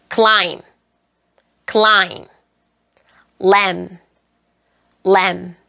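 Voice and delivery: "climb" as "klaim", "lamb" as "lam"; silent B. Shown as a demonstration. Teacher models silent B, "climb" and "lamb" ending on m. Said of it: "B가 묵음이 되는 단어들".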